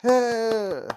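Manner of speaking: high excited whoop; cheering yeah. Real, not acted. Cheer after midnight.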